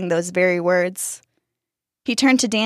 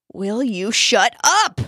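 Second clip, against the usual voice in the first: slow, higher, exasperated demand; annoyed shout; 4 semitones higher, slower than usual. A narrator's reading. Brother's head to the prankster, irritated shout. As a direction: stretch it out, rising in irritation. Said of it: "the brother's head shouted".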